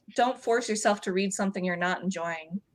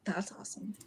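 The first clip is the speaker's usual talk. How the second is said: breathy whisper aside; 13 dB quieter, faster than usual. Real, not acted. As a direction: whisper it under your breath. Soft aside on the call.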